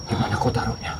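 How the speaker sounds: soft whispered command; whispered warning. Acted. Jakabaya whispers to silence the captive. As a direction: hush him in a whisper.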